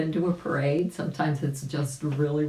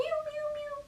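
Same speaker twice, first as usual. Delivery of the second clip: very high slow little mews; tiny kitten mews; kitty meows; 8 dB quieter than usual. Acted. Little kitty birds answering, very high mews. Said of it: "they all went meow".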